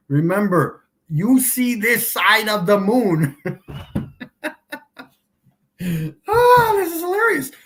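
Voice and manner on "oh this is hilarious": squealing high through a laugh; laughing so hard the voice goes shrill; real laughter. Real, not acted. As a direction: crack up while saying it, much higher and louder. Cracking up at flat earthers.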